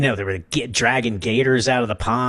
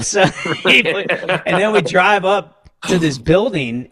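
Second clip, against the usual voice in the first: laughing while talking; 4 semitones higher, slower than usual. Real, not acted.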